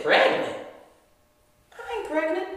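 mild incredulous denial; disbelief. Retold or imitated. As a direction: scoff at the idea, incredulous. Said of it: "I said".

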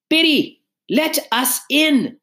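high, drawn-out yell; shrill-pitched yell through a door. A narrator's reading. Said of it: "she heard Missus Tifton yell".

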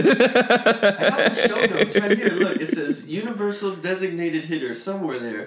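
talking through laughter, high and loud; laughing. Real, not acted. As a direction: laugh while reading it out. Confused by show notes, laughing.

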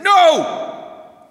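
sharp high shout of refusal. Acted. Mean old man snapping no at the wise man. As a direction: bark a loud flat refusal. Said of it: "Mister Mean, he said".

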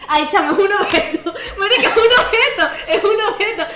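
loud, high protest through laughter; laughing protest. Real, not acted. Player defends juice as an object, laughing protest. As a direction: argue loudly while cracking up. Heated laughing dispute over the answer.

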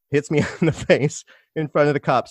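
laughing through the words. Real, not acted. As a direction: chuckle while telling it. Laughing while telling.

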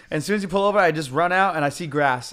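normal talking voice

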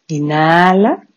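each cue drawn out on a rise; paced breath cues. Shown as a demonstration. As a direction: lead the breathing cycle in steady time. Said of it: "nuestro pranayama".

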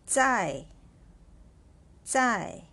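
在 said slowly with a firm falling fourth tone; dictating Chinese word. Shown as a demonstration. Teacher dictating 在 to students, firm falling fourth tone. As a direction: give 在 as a clear dictation word, dropping sharply. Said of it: "what is the pinyin for 在".

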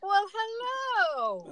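very slow, high, sing-song hello; drawn-out hello. Real, not acted. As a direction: stretch the greeting out playfully. Greeting newcomer, drawn out.

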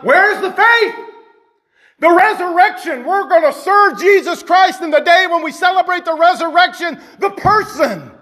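high-pitched, full-force sermon delivery; impassioned preaching. Real, not acted. Preacher tells church He is the resurrection, fervent proclamation.

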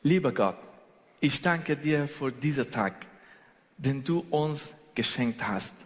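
ordinary delivery

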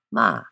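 "ma" high and loud, falling fast; fourth tone. Shown as a demonstration. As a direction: drop "ma" sharply from the top. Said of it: "a falling note".

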